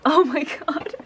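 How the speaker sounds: loud, high, appalled and amused; real laughing outcry; laughing disbelief. Real, not acted. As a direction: exclaim as you break into laughter.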